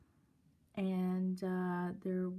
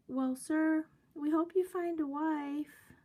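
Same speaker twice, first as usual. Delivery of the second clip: high, raised-voice mimicked singsong; sing-songy girl; 9 semitones higher, 4 dB louder than usual. Girl ending chat with older man, mimicked sing-songy. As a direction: imitate a young girl sweetly signing off. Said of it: "talk in a real sing-songy voice".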